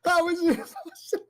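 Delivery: high-pitched disbelieving outburst; incredulous. Real, not acted. Player reacts in disbelief, high-pitched.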